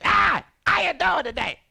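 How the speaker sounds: high and loud brash street voice; hammed-up little [name] persona greeting the crowd. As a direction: bring out the loud alter ego. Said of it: "bring little [name]".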